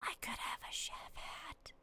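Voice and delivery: breathy whispered wonder. Real, not acted. Streamer whispering to herself about a chef hat. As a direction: hush it like a secret. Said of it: "I don't know why I'm whispering".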